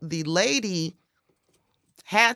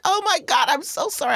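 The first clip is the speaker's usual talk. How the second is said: embarrassed apology; 6 semitones higher than usual. Retold or imitated. Owner apologizes for licking.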